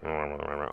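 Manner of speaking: low, soft crowd rumble; low grumbling rumble. Shown as a demonstration. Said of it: "low rumbling sound".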